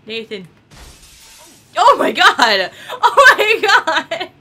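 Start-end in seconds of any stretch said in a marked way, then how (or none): 1.8-4.3 laughing out loud; helpless laughter. Real, not acted. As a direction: burst out laughing at the chaos. Laughing at game chaos.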